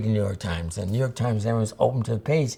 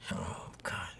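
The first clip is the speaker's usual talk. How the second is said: groggy whisper; 2 semitones lower, 17 dB quieter than usual. Retold or imitated. Woken at three to read.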